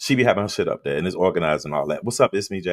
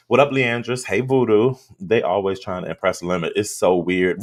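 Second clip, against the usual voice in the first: ordinary talk, nothing marked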